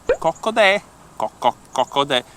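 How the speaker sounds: clucked Coccodè; Italian hen cluck. Shown as a demonstration. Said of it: "in Italian we say".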